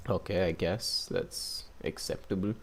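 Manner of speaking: usual speaking voice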